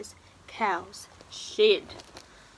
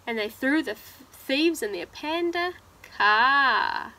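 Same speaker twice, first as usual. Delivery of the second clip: animated, louder storybook telling; reading to children; 5 dB louder, slower than usual. Acted. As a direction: dramatise the climax for young listeners. Reads picture book aloud.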